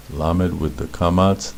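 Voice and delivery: ordinary talk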